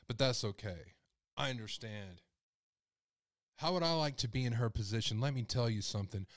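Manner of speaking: ordinary talk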